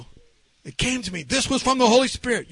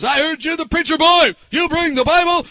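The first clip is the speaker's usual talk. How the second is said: raised, higher-pitched yell of an agitated man; imitated yelling; 7 semitones higher, 6 dB louder than usual. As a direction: mimic a man yelling at the preacher. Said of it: "he began yelling at me".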